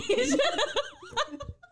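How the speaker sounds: high hard laugh; loud laughter. Real, not acted. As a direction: burst out laughing.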